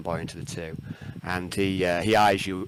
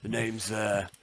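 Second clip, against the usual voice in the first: a hint of a gruff orc, slow on the name; lightly voiced orc captain, slow and sure; orc boss voice; slower than usual. Orc captain introduces himself, lightly voiced gruff orc. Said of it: "the orc says".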